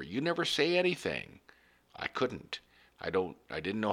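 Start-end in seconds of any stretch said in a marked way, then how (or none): 0.0-1.4 a light hint of the wife, higher and reproachful; wife's complaint. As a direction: reproach him the way his wife did, lightly. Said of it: "My wife said over and over".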